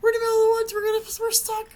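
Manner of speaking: very high, loud mock-panicked cry; imitated panic. Daughter to parent, stuck in the woods, imitated high loud panic. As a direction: imitate a frantic daughter shrieking. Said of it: "she starts panicking".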